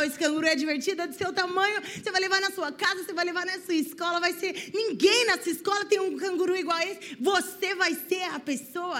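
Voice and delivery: vendedor insistente. Retold or imitated. Vendedor insistindo com a criança.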